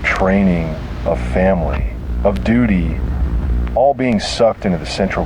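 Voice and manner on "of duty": usual speaking voice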